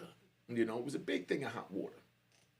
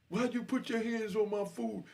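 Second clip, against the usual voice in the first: raised, high imitation of an angry demand; retold angry challenge; 10 semitones higher than usual. Angry dude repeating himself face to face, retold challenge.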